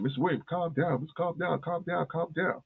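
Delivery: mock soothing, high and hushed; soothing staff. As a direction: staff calming a panicked patient, mock soothing.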